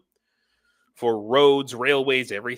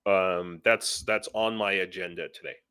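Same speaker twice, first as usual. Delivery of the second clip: usual speaking voice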